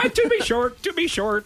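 laughing mock Irish. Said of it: "they say to, to be sure".

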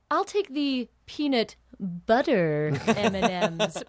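slow, self-satisfied order, savoring BUTTER; smug little grin. Retold or imitated. Customer feeling adventurous at the movie counter, smug. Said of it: "with a fucking smug little grin".

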